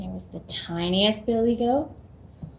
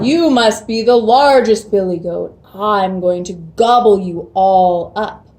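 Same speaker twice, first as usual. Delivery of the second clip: booming troll; 9 dB louder than usual. Acted. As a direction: boom it out like a troll guarding his bridge. Troll threatens biggest goat.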